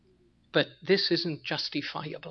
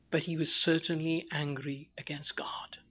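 whisper; 7 dB quieter than usual. Acted. Grave point about Cain.

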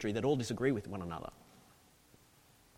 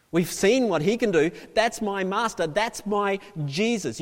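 raised, louder, emphatic declaration; fervent preaching; 7 semitones higher, 7 dB louder than usual. Acted. Preacher speaking for trusting disciples, fervent preaching. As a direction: proclaim it like a testimony.

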